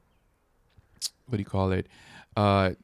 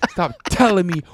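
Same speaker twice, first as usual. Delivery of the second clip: annoyed scolding; 7 semitones higher, 11 dB louder than usual. Retold or imitated. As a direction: drag it out like a fed-up partner. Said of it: "she was just like".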